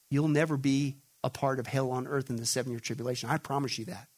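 hushed, low assurance; quietly sworn vow; solemn promise. Acted. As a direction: drop low and soft to promise. Solemn promise given in Jesus' words.

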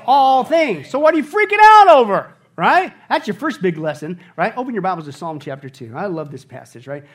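ordinary talk, nothing marked

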